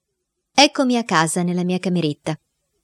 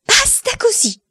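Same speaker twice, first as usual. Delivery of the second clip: much higher shouted cutoff; aunt shouting; slower than usual. Acted. Aunt Bettina shouts to interrupt the boy. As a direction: cut him off like a furious aunt. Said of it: "ha gridato la zia Bettina".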